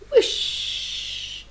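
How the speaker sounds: long breathy whoosh trailing into a hiss; arrow whoosh. Acted. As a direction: whoosh like an arrow flying past. Imitates the arrow's flight.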